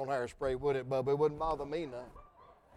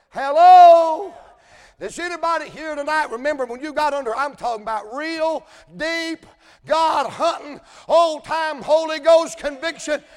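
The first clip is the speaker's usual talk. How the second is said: full-force preaching; 10 semitones higher, 13 dB louder than usual. Acted. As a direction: rise into a hollering sermon climax. Preaching builds again.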